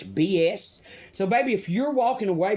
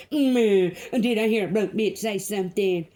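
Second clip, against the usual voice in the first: a haughty, sneering taunt with a drawn-out "Mm"; mocking impression of critics; 4 semitones higher than usual. Mimicking internet critics who screamed at her, sneering and haughty. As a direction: imitate them looking down on her, voice pitched up and sneering. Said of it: "I'm gonna say it exactly how they said it".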